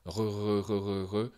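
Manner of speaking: Re with a buzzing French R voiced in the throat; vibrating uvular R. Shown as a demonstration. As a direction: let the vocal cords vibrate through the R. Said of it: "faire vibrer au long des cordes vocales".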